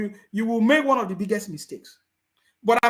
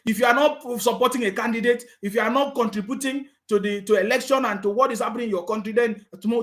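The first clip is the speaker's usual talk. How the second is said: ordinary talk, nothing marked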